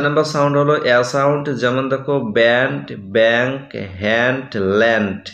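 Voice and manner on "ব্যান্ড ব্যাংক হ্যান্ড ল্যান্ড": slow, open a vowel in ব্যান্ড, ব্যাংক; flat a sound. Shown as a demonstration. Teacher to class, slow demo of the short flat a. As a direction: draw out হ্যান্ড and ল্যান্ড slowly with a flat a. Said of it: "এর মতো sound পাচ্ছি".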